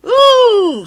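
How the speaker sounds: very high loud scandalised shriek; shrieking coaches. A narrator's reading. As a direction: let out a loud high squeal. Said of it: "screamed Agnes".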